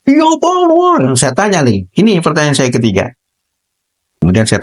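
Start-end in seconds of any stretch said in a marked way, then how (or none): 0.0-1.2 high timid student voice; voicing the student; student's reply. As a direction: answer like a scared kid.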